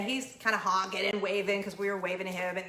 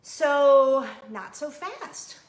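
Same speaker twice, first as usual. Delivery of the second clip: slow and drawn out, louder, like a plot twist; dramatic slow warning; 3 semitones higher, 7 dB louder, much slower than usual. Real, not acted. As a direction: stretch it out teasingly.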